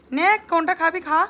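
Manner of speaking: high, loud offer; calling out. Real, not acted. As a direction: call to her to pick one. Offering food to Anita. (অনিতাকে খাবার দেওয়া, উঁচু গলায় ডাক)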